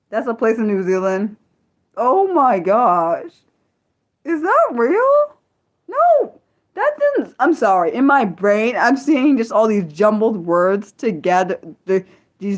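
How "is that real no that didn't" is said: very high, slow squeak of disbelief; utter disbelief. Real, not acted. Streamer refusing to believe the place name, high squeak. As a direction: plead that it isn't real.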